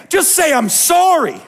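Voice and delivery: high, loud preaching cry; passionate shout. Real, not acted. Pastor crying out to his church in frustration, loud and high. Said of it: "That's why I lost my mind".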